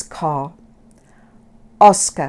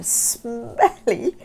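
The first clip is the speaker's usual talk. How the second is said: plain reading voice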